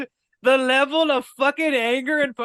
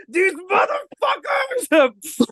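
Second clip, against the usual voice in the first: slow, high hammed-up yell at the store; mock road-rage scream; mock furious shout; 10 semitones higher, much slower than usual. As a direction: bellow it like you're ramming a car through the front. Driver crashing into store.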